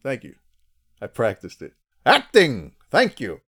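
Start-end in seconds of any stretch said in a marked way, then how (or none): 2.1-3.4 loud, high mock-grand exclamation; theatrical self-congratulation, belted out; hammy thespian. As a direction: proclaim it like a ham taking a bow. Hammy bow after praised impression.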